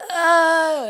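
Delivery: sudden loud high grief wail; wailing cry. Retold or imitated. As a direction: let out a short anguished wail. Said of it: "gut-wrenching wailing and moaning".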